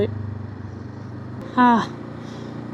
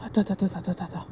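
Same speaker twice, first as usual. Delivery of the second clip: hushed; 4 semitones lower, 11 dB quieter, faster than usual. Real, not acted. Spooked on a night ride.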